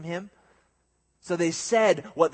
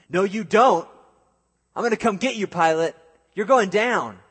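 loud, higher hammed-up bravado; mock tough-guy threat; 6 semitones higher, 8 dB louder than usual. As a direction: pretend Jesus trash-talks Pilate. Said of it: "No, Jesus has a calm response".

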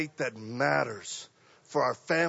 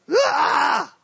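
sharp high "Ah!" of panic; startled yelp; 10 semitones higher, 7 dB louder than usual. Retold or imitated. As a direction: yelp like you're freaking out. Freaking out at fantasy.

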